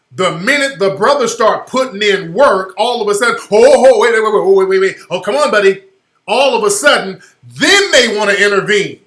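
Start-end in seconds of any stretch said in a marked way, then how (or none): 3.5-5.8 loud, urgent protest, words tumbling out; hammed-up bystander jumping in to break it up; mock alarmed intervention. Bystanders rush to stop the brother putting in work, mock alarm.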